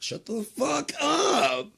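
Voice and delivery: high, loud, drawn-out screaming yell; furious shout. Pretend. Streamer screaming at the chat to shut up, high and loud. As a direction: scream it at full force.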